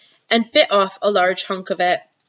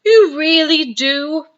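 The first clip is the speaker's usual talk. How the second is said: higher, louder, slow eager question; delighted; 8 semitones higher, 5 dB louder, slower than usual. Acted. Giant praised by Sophie, eager and delighted. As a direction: beg to hear it again. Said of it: "suddenly brightening".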